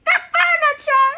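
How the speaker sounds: high, loud cry of dismay; cried out. A narrator's reading. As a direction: shriek in horror. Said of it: "she cried".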